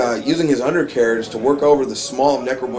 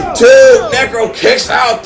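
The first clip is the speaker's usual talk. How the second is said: excited count; 7 semitones higher, 5 dB louder, slower than usual. Real, not acted. Counting a near pinfall.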